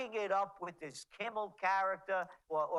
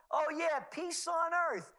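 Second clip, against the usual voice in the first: sarcastic; 7 semitones higher than usual. Acted. Sarcastic jab at Libyan war.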